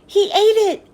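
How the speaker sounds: put-on girl telling the crowding kids, excited announcement; announced. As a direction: announce it to the crowd, half laughing. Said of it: "Dee Dee announced".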